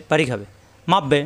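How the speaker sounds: ordinary talk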